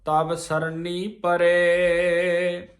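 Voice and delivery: slow chanted scripture line; slow recitation. Shown as a demonstration. Teacher reciting gurbani slowly for students. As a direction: recite it slowly with a pause after ਧੁਜ. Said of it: "ਇਹ ਇੱਥੇ ਅਸ ਧੁਜ ਤੇ ਵਿਸਰਾਮ ਹੈ".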